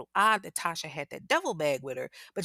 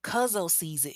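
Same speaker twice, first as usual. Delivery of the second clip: ordinary talk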